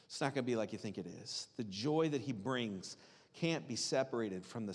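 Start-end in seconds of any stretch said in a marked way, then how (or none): 0.0-1.5 much lower and much softer, tender; very gentle. Retold or imitated. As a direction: let him down softly, like a son soothing his mother. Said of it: "And Jesus is just very gently like".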